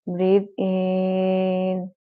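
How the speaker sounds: slow, level guidance with a long "in"; drawn-out breath cue. Shown as a demonstration. Teacher cueing one last chant, slow and stretched. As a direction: lengthen each word to pace the breath.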